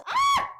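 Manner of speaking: sudden very high short shriek; frustrated shriek. Real, not acted. Shriek of frustration while cutting wallpaper. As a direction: yelp as the blade slips again.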